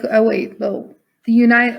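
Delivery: ordinary talk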